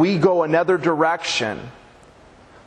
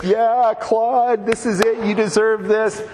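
excited family; 7 semitones higher than usual. Retold or imitated. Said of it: "And they're like".

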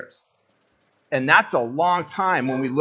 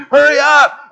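high, loud hurry up; frantic mock shout; impatient yell; 10 semitones higher, 11 dB louder than usual. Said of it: "I'm freaking out".

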